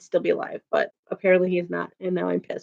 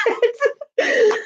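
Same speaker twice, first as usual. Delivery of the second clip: squealing with laughter, pitched way up; giggly mock threat; laughing riot. Real, not acted. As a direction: laugh the word out high. Joking about rioting, laughing.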